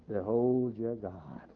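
slow, low, hushed proclamation; solemn awe. Acted. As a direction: drop down soft and draw it out reverently. Climax of the proclaimed prophecy.

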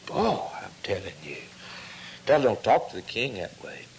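hushed, unvoiced aside; whisper. Real, not acted. Preacher whispering an aside to the church. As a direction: lean in and whisper it. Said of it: "whispered aside to congregation".

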